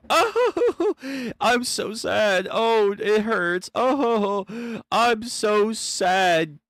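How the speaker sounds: hammed-up fake sobbing, high and slow; mock crying. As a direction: overdo a fake sad wail. Character pretends to cry.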